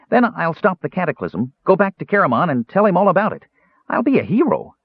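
high, eager kender voice; a young kender dreaming of glory; boastful kender. A narrator's reading. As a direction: kender imagines being a hero, high and eager.

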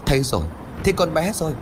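high excited call across the river; calling out loud. A narrator's reading. Ông Tuấn calling to the searchers, loud and high. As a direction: shout it out, he's found her. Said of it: "Ông Tuấn đột ngột hô lớn".

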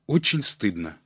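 plain reading voice

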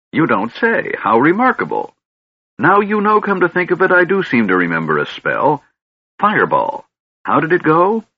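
put-on high, wondering old man voice; pleased to be told he's a mage; delighted old wizard. Fizban delighted musing.

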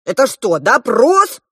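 loud burst of protest, climbing high; exasperated. A narrator's reading. The elder prince challenges the questioning, exasperated. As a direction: snap loudly, out of patience. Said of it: "вдруг не выдержал старший принц".

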